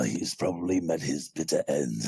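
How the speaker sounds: deep, dark voice ending in a laugh; low menacing line with a chuckle; Padre character voice. Shown as a demonstration. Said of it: "You laughed as the character".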